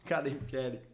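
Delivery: rising, shouted football commentary; live play-by-play. Acted. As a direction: call the attack like a TV commentator. Final seconds commentary.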